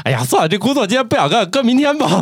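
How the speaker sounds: high-pitched mock procrastinator, giving up; giving up lazily. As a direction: whine about putting work off, jokingly. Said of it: "你想咱这是吧".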